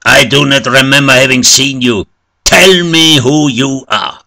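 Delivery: loud, high, insistent priest; stern demand. A narrator's reading. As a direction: demand an answer forcefully. Priest confronts the wounded stranger.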